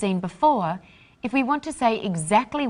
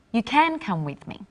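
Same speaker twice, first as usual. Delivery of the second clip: "can" stressed, then falling through "come with me"; 2 semitones higher than usual. Shown as a demonstration. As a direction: emphasise "can" to show it is now allowed. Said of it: "but now you can".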